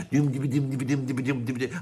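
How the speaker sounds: quick chugging "dum" rhythm done by mouth; galloping riff imitation. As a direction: mimic a galloping metal bassline in a steady patter. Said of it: "but they're a bit gallopy".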